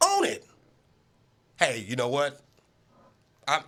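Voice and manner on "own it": insistent demand. Acted. Demanding Brady accept it.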